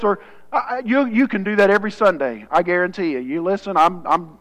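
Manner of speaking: ordinary talk, nothing marked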